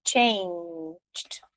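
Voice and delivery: "Changed" whispered, ending with a soft t. Shown as a demonstration. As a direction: breathe "Changed" with a faint t at the end. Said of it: "ganha esse mais um Tzinho".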